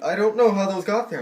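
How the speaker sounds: loud, high-pitched mock innocence; acted-out indignant denial; protesting denial. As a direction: blurt it out high and loud, caught red-handed. Caught thief's acted denial.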